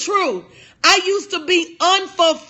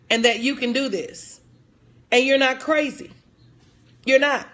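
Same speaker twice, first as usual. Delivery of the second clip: tender reassurance; 4 semitones lower than usual. Real, not acted. Coach telling women their ideas are good, tender reassurance. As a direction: encourage them gently, voice lowered.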